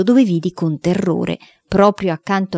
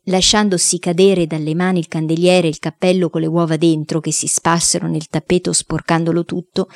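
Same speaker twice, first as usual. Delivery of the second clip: ordinary delivery, nothing marked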